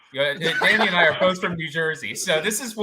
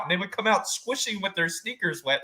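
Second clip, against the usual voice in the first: ordinary delivery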